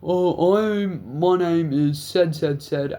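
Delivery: mocking the cop who caught them; imitating a cop. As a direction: put on a stiff official cop voice. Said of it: "and he's like".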